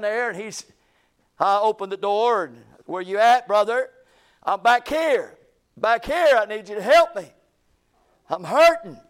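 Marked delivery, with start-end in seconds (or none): 2.8-3.9 loud call through the house. Retold or imitated. Calling to man inside house. 4.4-9.0 loud, imitated cry for help; pained calling out. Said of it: "Got tears rolling down his eyes".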